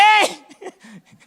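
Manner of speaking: high burst of laughter. Real, not acted. Laughing at own mock cry.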